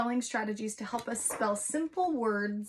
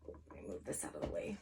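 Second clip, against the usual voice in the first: quick, much softer whisper; whispered aside; 16 dB quieter, faster than usual. Real, not acted. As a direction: mutter it to yourself while tidying. Aside while moving board.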